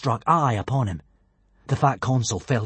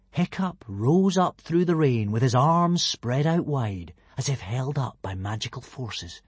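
usual speaking voice

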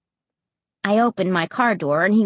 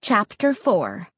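ordinary delivery

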